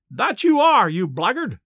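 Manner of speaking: high, loud spiteful jeer; spiteful yell. A narrator's reading. Detective jeering back at Larry, spiteful high yell. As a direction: spit the insult up high and loud. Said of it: "yelled the officer, so spitefully".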